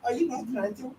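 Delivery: a mild mock of a sweet, eager mum; mother's voice. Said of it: "dia kata".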